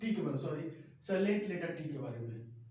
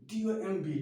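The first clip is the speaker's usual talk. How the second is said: ordinary delivery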